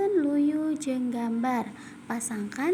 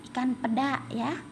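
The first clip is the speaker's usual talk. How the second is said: ordinary talk, nothing marked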